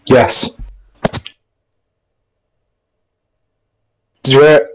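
suddenly blaring, checking the sound works; loud. Real, not acted. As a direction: come in loud and close. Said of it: "You sound s".

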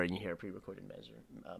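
ordinary delivery

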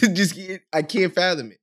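usual speaking voice

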